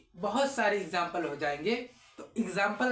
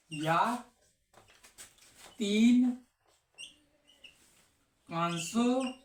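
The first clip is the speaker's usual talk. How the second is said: slow dictation; much slower than usual. Shown as a demonstration. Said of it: "slow dictation of second rule".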